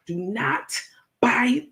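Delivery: "Do not" then "buy," each word cleanly separated; over-enunciate "Do not buy," with a pause; crisp diction. Retold or imitated. Mocking Crook's careful warning, crisp enunciation. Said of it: "she's speaking crispy clear".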